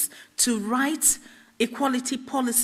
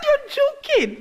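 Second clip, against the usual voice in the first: high, loud squeal of disbelief; mock disbelief; 6 dB louder, slower than usual. Candidate retells hearing she got the job, disbelieving. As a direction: squeak it out, astonished. Retold reaction to getting the job.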